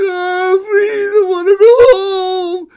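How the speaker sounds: hammed-up loud, high wail; crying little girl. Girl pleads to go home, hammed-up loud crying. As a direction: sob it out in a piercing child voice. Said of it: "started to cry and said".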